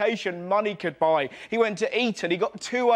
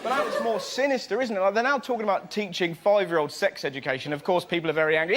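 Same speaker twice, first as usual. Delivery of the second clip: ordinary delivery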